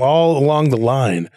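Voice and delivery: usual speaking voice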